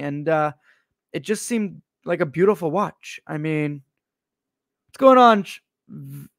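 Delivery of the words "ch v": ordinary delivery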